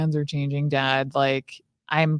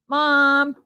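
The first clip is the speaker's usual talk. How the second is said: calling out; 9 semitones higher, 4 dB louder than usual. Acted. Teen calls for mom mid-argument.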